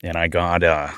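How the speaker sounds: low-pitched Australian accent practice. Shown as a demonstration. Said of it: "I have to get Australian".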